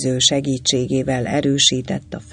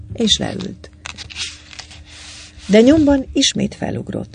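usual speaking voice

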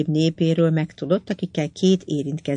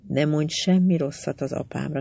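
ordinary delivery

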